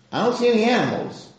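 put-on a boy asking where the sheep is; child's puzzled voice. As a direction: ask like a puzzled little boy. Young Yitzchak asking his father.

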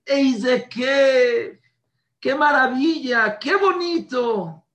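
high, loud cry of wonder that softens; exultant praise. Retold or imitated. Father marvelling in high, loud praise.

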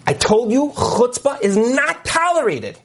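high, drawn-out indignant reprimand; stern scolding. Retold or imitated. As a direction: act out a teacher's outraged telling-off. Retold rabbi scolds the boy.

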